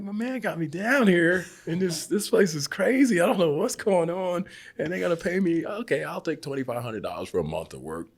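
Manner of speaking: high, loud bewildered rant; incredulous. Retold or imitated. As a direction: rant in disbelief at the place. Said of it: "I'm like".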